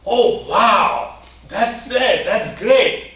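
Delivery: high, bright burst of joy; overjoyed at the news; delighted. Acted. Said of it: "that guy becomes very happy".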